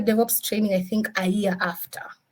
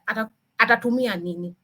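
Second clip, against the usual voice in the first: normal talking voice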